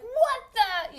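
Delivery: loud, high-pitched retold disbelief; baffled outburst. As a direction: imitate him, stunned and indignant. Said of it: "so he's just like".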